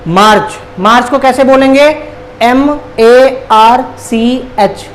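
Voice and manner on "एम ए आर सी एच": slow, letter by letter; spelling out. Shown as a demonstration. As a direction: spell it out slowly with a gap after each letter. Spelling the month for learners. (एक-एक अक्षर धीरे-धीरे)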